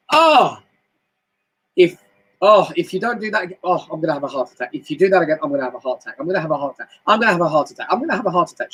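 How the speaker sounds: high dramatic "Oh" then moaning complaint; exasperated woman. Retold or imitated. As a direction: ham up a fed-up woman blaming someone. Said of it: "will turn around and go".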